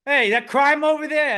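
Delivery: a hint of Matt, hailing high and loud; mock-call to a passerby, high and loud; imitating Matt. Friend mimics Matt calling out, mild loud impression. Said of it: "you know how Matt, like".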